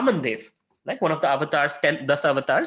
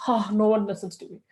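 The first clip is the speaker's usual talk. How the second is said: a mock wife's whine, pitched up; 5 semitones higher than usual. Wife lamenting nobody listens, mock whine. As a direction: sigh it out as if nobody cares.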